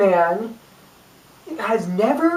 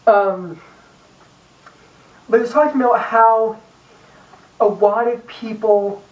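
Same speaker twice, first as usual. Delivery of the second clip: usual speaking voice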